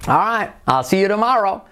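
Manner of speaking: "I'll see you tomorrow" high and lilting; high, tuneful "All right, I'll see you tomorrow"; lilting goodbye on "see you tomorrow"; sing-song intonation. Shown as a demonstration. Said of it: "son como muy cantarines".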